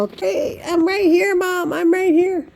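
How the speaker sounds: voicing an eager dog. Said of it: "Shine's over there looking all".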